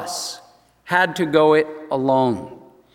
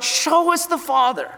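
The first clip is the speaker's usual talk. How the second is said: imitating Philip, pitch jumping higher on the plea; Philip's plea; 8 semitones higher than usual. Philip pleading with Jesus to see the Father, mock plea higher. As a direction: beg to be shown, voice rising high. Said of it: "when Philip is saying".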